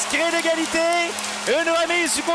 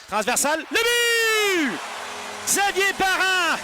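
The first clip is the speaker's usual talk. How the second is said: goal call; 2 semitones higher, slower than usual. Real, not acted. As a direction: shout the goal. Goal call in hockey play-by-play.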